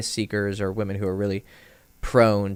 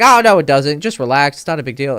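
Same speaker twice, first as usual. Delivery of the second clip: loud, higher mock protest; dismissive brush-off; 5 semitones higher, 11 dB louder than usual. Imitating the teammate telling him to just relax, dismissive. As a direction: wave the complaint away loudly, easing off by the end.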